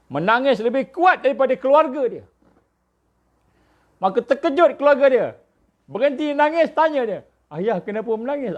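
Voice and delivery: high-pitched urgent sermon delivery; impassioned preaching. Acted. (khutbah bersemangat, nada tinggi)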